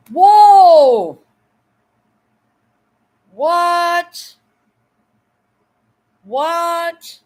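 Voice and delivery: long, high-pitched astonished exclamations; stunned surprise. Real, not acted. Streamer reacting to a viewer's generous gift, stunned. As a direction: cry out in real disbelief, drawing it out.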